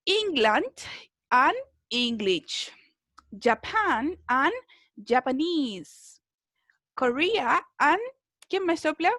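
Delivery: slow, carefully spaced word-by-word model reading; pronunciation drill. Shown as a demonstration. Teacher reading out vocabulary slowly to a class for pronunciation practice. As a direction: read each country and nationality slowly so students can copy it.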